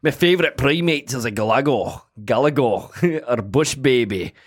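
put-on a lightly done Scottish accent breaking into laughs. A host trying Scottish for the accent game names the bush baby, laughing, in a light Scottish accent. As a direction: push through a Scottish brogue while giggling. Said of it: "can I do Scottish?".

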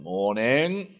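put-on Welsh-accented morning greeting. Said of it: "if I did it in a Welsh accent".

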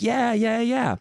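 high, strained yeah yeah; forced agreement. Retold or imitated. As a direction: nod along with a high forced voice.

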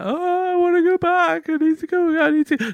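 very high, pleading panic; panicking kid. Retold or imitated. Boy panics after leaving the shop with a game, high panic. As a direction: blurt it like a frightened child.

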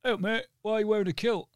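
high, lightly voiced kid asking; a child's cheeky question, mimicked high; imitating a kid. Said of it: "these couple of kids came over".

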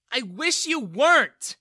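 high, drawn-out shout; angry yell. A narrator's reading. Daughter disowning her father, yelling. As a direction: hurl it back at full voice. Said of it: "Bella yelled at him".

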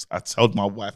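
normal talking voice

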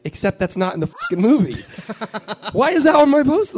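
loud, high, half-laughing outrage; exasperated protest. Retold or imitated. Director objecting to the camcorder on his poster, comic exasperation.

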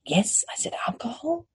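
whispered aside, as if confessing; conspiratorial stage whisper. Acted. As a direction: lean in and whisper it. Confiding aside to viewers.

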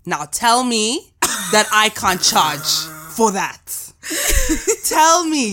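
loud, drawn-out challenge to the listeners; loud boast. Real, not acted. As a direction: crow it out loud and slow. Boasts after accent demo.

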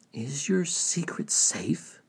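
slow, hushed question; secretive whisper. A narrator's reading. Jungi asks Tortoise about his secret, whispering. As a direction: whisper it slowly, as if guarding a secret.

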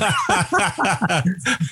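high giggling laugh. Real, not acted. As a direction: burst out giggling. Laughing at dad's band.

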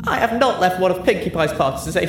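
put-on high, brash drunken brag; tipsy boasting. A narrator's reading. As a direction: show off to an old friend. Drunk Rainbow Dash boasts.

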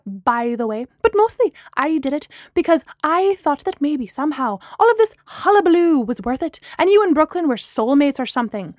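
loud, high-pitched indignant tirade; angry shouted rant. A narrator's reading. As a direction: let the outraged voicemail rant pour out. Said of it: "she shouted into the mouthpiece".

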